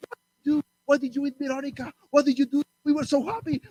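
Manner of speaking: high accusing questions; upset animals. Acted. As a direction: demand answers from the lion.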